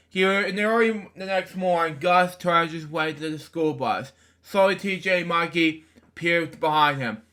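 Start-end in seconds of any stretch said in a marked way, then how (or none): none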